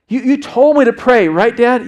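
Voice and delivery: imitating an impassioned son; earnest son confronting dad. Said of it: "He looks me in the eye and says".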